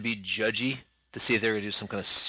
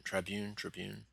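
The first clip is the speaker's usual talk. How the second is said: fading, repeated word; trailing off; 11 dB quieter, slower than usual. Real, not acted. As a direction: let it die away. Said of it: "That's the word".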